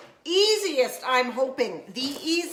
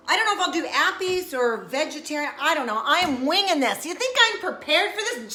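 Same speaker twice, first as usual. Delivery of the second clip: ordinary delivery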